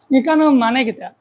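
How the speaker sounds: usual speaking voice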